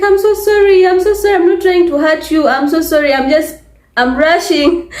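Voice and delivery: high, hurried imitation of a sorry man; flustered apology. Man who startled her keeps apologising, high flustered voice. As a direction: plead that you meant no harm.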